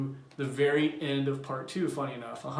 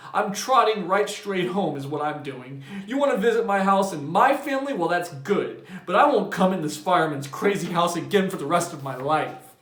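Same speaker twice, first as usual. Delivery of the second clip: loud, indignant; huffy refusal; 4 semitones higher, 6 dB louder than usual. A narrator's reading. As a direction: storm off in a huff. Bowles storms out.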